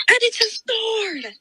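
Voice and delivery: dramatic reveal. Real, not acted. Friend retelling the book builds to the sword, dramatic reveal. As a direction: stretch it out for the big reveal.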